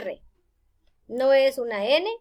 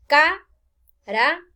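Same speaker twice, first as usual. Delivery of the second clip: "Ca-ra-col" in three slow, separate, louder beats; syllable by syllable; 3 semitones higher, 6 dB louder, much slower than usual. Shown as a demonstration. Teacher counting three syllables for students, syllable by syllable. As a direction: break "Ca-ra-col" apart with clear pauses. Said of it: "tiene tres sílabas".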